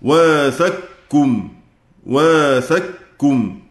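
واثقكم said very slowly as wathakkum, qaf merged into kaf; slow demo of واثقكم with full idgham; واثقكم drawn out with the qaf assimilated into kaf; merged reading. Shown as a demonstration. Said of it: "ميم جمع".

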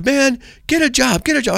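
high-pitched mock heckle; imitated yelling. Driver heckling a street preacher, imitated yelling. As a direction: imitate a driver hollering, without much volume. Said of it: "he starts yelling at me".

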